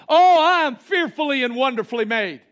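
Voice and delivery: high, loud joyful declaration; joyful proclamation. Shown as a demonstration. Pastor shows what believers will become, joyfully proclaiming. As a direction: ring it out with delight. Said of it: "start saying what God has declared".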